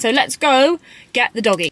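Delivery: usual speaking voice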